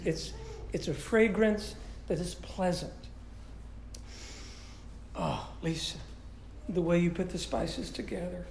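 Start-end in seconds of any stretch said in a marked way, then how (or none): 5.1-8.5 soft, low, savoring murmur to the wife; savoring praise. Acted. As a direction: sigh it out as if tasting the sauce. Savoring wife's spaghetti aloud.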